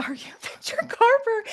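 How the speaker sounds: high, breathless starstruck squeal; starstruck fan. Retold or imitated. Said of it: "I was like".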